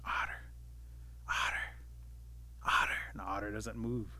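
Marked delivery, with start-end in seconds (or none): 0.0-3.0 hushed whisper, a pause between each call. Acted. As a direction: whisper the name over to someone asleep. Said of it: "Rabbit starts whispering over to Otter".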